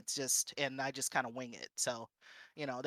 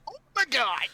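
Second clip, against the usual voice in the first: higher, louder mock gasp of shock; shocked oh my god; 11 semitones higher, 7 dB louder than usual. Reader reliving a racy chapter at work, mock shocked. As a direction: squeal it like you just read something scandalous. Retelling reading spicy app at work.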